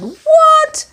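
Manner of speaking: sudden high, loud shout; a startled man shouting from his car; shouted what. Acted. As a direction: bark a startled question. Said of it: "The man shouted".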